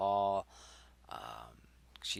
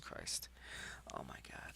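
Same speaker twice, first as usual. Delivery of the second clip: breathy whisper under the breath; hushed dismay; whispered oh my god; 10 semitones lower, 13 dB quieter than usual. Real, not acted. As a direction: mutter it to yourself in a whisper. Reacting to own script, aside.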